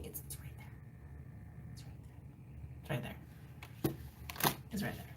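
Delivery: soft repeated near-silent whisper; hushed whisper. Real, not acted. Reader whispers to herself that it's right there. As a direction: barely breathe it, fading.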